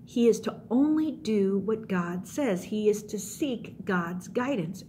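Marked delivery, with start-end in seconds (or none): none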